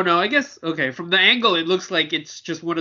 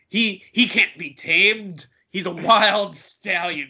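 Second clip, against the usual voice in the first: wrestling manager voice; 3 semitones higher than usual. Pretend. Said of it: "You should definitely be a wrestling manager".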